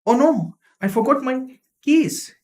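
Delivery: high-pitched dismayed oh no; surprise and curse. Shown as a demonstration. Teacher showing surprise at forgotten keys, high and dismayed. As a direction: realize the keys are gone. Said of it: "Combination of two expressions, surprise and curse".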